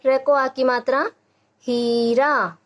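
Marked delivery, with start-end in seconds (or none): 1.7-2.6 हीरा with ee and aa drawn out; long vowels. Shown as a demonstration. Teacher sounding out a spelled word for children, long vowels. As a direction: stretch both syllables of हीरा. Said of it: "इसका उच्चारण थोड़ा लंबा".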